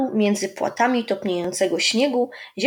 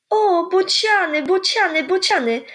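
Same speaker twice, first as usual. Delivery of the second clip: excited calling; 11 semitones higher, 3 dB louder than usual. A narrator's reading. Ada calls out on seeing the storks, excited and fading. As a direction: shout out at the storks. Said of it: "zawołała Ada".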